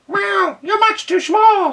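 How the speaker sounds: very high, loud meowing cat snap; snapping cat. A narrator's reading. Cat snapping at the duck, high and loud. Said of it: "snapped the cat".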